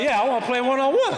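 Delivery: high, loud, fired-up answer; eager cocky reply. Retold or imitated. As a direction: accept the challenge with bravado.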